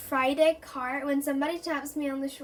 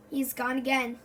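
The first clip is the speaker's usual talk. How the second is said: ordinary delivery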